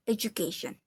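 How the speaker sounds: education in a lightly American accent; American-style education with a blended "ju". Shown as a demonstration. Student compares accents, American-style education. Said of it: "In American accent, it is".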